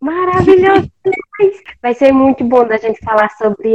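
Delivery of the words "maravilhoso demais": high, amazed exclamation. Retold or imitated. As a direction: gasp out the wonder. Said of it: "eu fiquei tipo".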